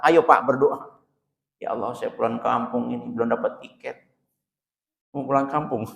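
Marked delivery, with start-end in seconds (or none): 1.6-5.9 much softer, lower plea; soft pleading prayer. Retold or imitated. Worshipper asks God for a ticket home, a soft murmured plea.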